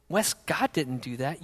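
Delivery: soft whisper, slowed down; quiet whispered rebuke. Retold or imitated. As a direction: lean in and whisper the hard truth. Mentor gently confronts him.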